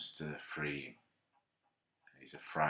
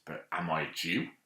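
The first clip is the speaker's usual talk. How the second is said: louder, indignant challenge, rising to a high cry; really irritated; 4 semitones higher, 6 dB louder than usual. A narrator's reading. Pilate confronting Jesus over his own people, irritated and loud. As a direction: let the irritation boil over on the last question. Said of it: "really irritated".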